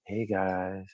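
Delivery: lightly voiced dopey guy; put-on idiot voice. Showing he could talk that way for rewards, mild idiot voice. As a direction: play a mild idiot greeting people. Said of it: "I could talk like an idiot".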